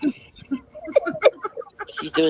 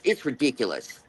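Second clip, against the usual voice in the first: ordinary talk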